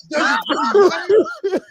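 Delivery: squeaky laugh breaking through the words. Real, not acted. Joking cohost asks through laughter if it matters.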